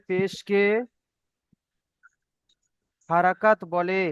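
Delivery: slow, measured phrases with long gaps; slow lesson recitation. Shown as a demonstration. Teacher drilling pupils who repeat each phrase, slow recitation. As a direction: recite slowly, leaving room to repeat. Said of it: "সবাই একা একা তিনবার পড়বেন".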